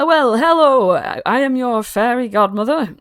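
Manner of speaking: high, loud, fussy fairy godmother greeting; grand fairy godmother. Acted. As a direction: play the flustered magical benefactor. Godmother grandly introduces herself.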